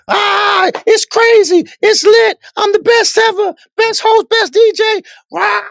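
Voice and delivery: full-volume, high-pitched screaming party host; super loud hype man. Retold or imitated. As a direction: scream it like an over-the-top club MC. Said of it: "And it's super loud".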